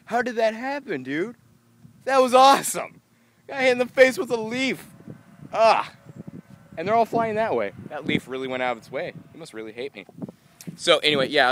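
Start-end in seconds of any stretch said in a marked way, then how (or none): 0.1-7.7 much higher, louder thrilled voice; high, loud excited chatter; excited amazement. Real, not acted. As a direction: gush at the leaf that hit you. Amazed at the leaf.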